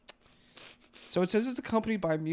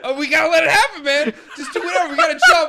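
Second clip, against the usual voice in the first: high, loud laughing shouts; 8 semitones higher, 14 dB louder, faster than usual. Real, not acted. Catharsis stage, laughing loudly.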